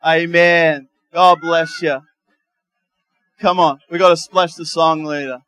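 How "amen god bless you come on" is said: usual speaking voice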